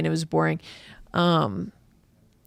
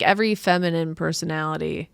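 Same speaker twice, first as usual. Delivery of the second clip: ordinary talk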